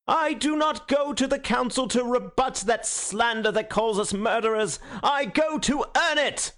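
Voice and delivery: high, ringing, pitiless oratory; thundering speech. A narrator's reading. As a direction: thunder it out to the hall. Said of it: "speaking in a peal of pitiless thunder".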